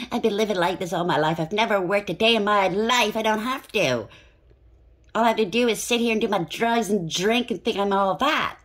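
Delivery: hammed-up, full-of-yourself voice; mock bragging. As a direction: brag loudly as the self-satisfied idler. Voices smug person whose energy she feels.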